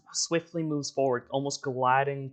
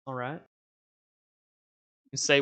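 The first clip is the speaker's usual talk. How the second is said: ordinary talk, nothing marked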